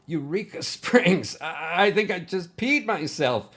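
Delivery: high, loud, shaken outburst; hammed-up relieved shout; shouting in relief. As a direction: yell it out, still rattled. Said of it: "Bug shouted".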